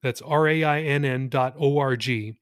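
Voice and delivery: ordinary delivery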